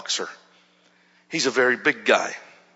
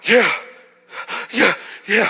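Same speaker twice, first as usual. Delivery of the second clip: loud, high, gulped yeahs; re-enacted breathless assent; gasping agreement; 7 semitones higher, 6 dB louder than usual. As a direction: gasp out each yeah between breaths. Acts Dennis's overwhelmed reply.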